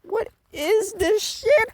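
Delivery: very high, slow incredulous cry; stunned disbelief. Retold or imitated. Reacting to the final episode, very high stunned cry. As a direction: stretch it out in shocked disbelief. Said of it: "and I'm like".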